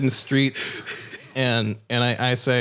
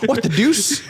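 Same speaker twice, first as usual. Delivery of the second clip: high, hammed-up English exclamation; mock English accent through laughter; English actor; 10 semitones higher, slower than usual. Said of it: "in the body of a English actor".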